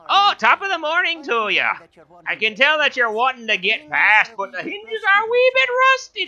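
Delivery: put-on high, lilting Irish accent; stage Irish door voice. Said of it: "we can give her a... Midwestern accent".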